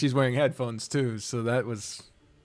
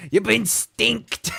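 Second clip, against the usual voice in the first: stinked; 6 semitones higher, 8 dB louder, slower than usual. Real, not acted. Teasing the dog.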